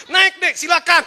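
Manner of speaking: loud, high, hearty invitation; eager calling out. Acted. Rider inviting a student to hop on, loud and eager. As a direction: call the passenger aboard with gusto.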